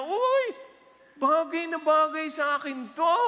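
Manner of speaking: high, loud gleeful gasp at a gift; delighted squeal. Acted. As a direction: squeal with joy over the present. Preacher acts delighted gift receiver. (tuwang-tuwang tili)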